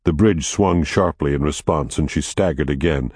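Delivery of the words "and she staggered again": ordinary delivery, nothing marked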